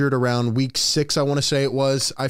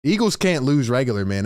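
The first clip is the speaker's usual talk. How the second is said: ordinary delivery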